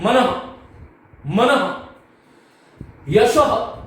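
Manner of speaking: मनः and यशः ending in an audible echoed "ha"; visarga demonstrated. Shown as a demonstration. Teacher shows students correct visarga, pronounced "manaha, yashaha". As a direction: sound out मनः, यशः with a firm breath of "ha" at the end. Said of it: "इसका उच्चारण कैसे होना चाहिए".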